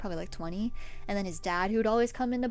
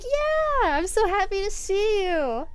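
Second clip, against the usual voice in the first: very high and bright, slow; happy; 10 semitones higher, 4 dB louder, slower than usual. Retold or imitated. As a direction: beam with joy. Own happy reply retold.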